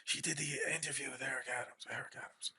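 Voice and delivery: very soft imitated whisper; Vito. Imitating a friend explaining who she is, whispering. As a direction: whisper it almost inaudibly, trailing away. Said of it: "Vito's whispering".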